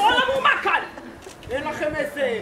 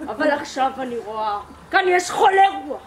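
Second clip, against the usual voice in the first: ordinary talk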